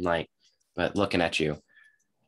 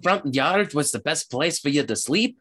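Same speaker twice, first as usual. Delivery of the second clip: higher rustic character voice; grumbling halfling; 7 semitones higher than usual. Acted. Halfling complaining about his grabbed tail, aggrieved. Game master plays the halfling.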